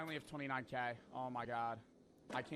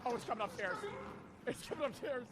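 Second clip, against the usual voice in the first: alarmed; 7 semitones higher than usual. Real, not acted. The enemy is closing in.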